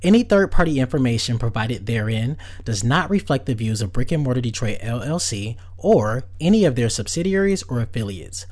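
loud, rushed disclaimer read; fast legal disclaimer. Acted. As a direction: rattle off the fine print.

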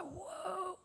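put-on a small child gasping in wonder; toddler's amazed whoa. Toddler seeing ducks on the phone, amazed whoa.

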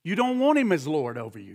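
normal talking voice

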